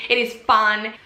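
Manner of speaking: ordinary talk, nothing marked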